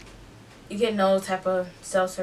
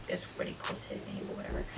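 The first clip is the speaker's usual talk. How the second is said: unvoiced, much softer aside to the viewer; whispered aside; 15 dB quieter, much faster than usual. Real, not acted. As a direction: drop to a whisper as an afterthought.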